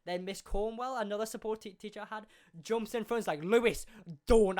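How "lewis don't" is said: louder, sharply raised mock order; retold teacher's warning. Support teacher jumping in front of Lewis, raised warning. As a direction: call out the name, then a firm stop. Teacher jumps in to stop fight.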